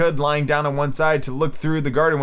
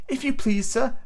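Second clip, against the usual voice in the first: timid; 9 semitones higher than usual. A narrator's reading. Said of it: "in a low, timid voice".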